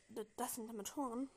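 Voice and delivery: usual speaking voice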